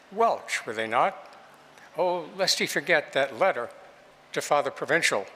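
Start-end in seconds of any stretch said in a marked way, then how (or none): none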